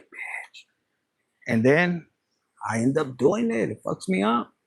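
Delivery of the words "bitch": breathy whispered insult under the breath; whispered bitch. Retold or imitated. Whispering to herself about a friend's request for a photo. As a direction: hiss it out as a voiceless aside.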